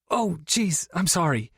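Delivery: high, hammed-up apologetic cry; a playful mock wail of sorry. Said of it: "Tori wailed".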